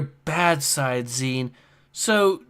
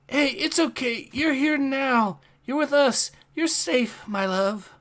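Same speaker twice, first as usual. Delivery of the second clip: soothing comfort; 7 semitones higher, 3 dB quieter than usual. Acted. Comforting partner after nightmare.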